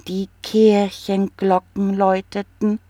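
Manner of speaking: plain reading voice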